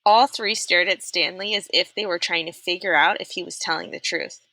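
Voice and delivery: usual speaking voice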